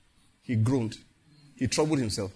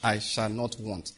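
prayer declaration; 4 semitones lower than usual. Shown as a demonstration.

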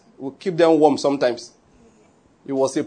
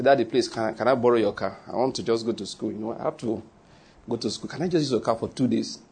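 lower, softer voice asking dad for a favour; pleading son; 7 semitones lower, 7 dB quieter than usual. Pretend. Son sweetly asking his daddy for the car, low and soft. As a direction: mildly play a son asking to borrow the car.

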